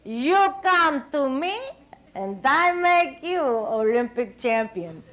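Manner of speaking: slow, put-on Hungarian accent; a Hungarian coach accent, drawn out. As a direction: imitate the Hungarian coach, slowly. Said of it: "in his deep Hungarian accent".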